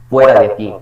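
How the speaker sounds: loud exclaimed "get out of here"; exclaimed command. Shown as a demonstration. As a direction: throw them out with a loud exclamation. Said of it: "Sentimiento o emoción".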